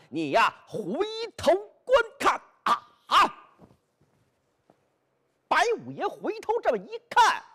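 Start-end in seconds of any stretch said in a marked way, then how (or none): 0.1-3.4 a high, ringing call to look behind you; hero's taunt. Acted. Hero calling to Bai Yutang to turn round, high ringing taunt. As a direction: sing it out like an opera hero springing a surprise.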